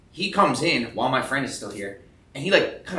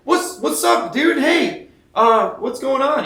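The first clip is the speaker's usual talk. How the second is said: excited greeting; 12 semitones higher, 7 dB louder, much slower than usual. Retold or imitated. Retold greeting to a shop guy.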